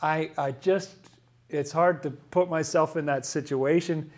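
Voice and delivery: ordinary talk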